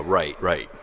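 ordinary talk

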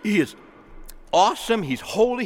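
ordinary talk, nothing marked

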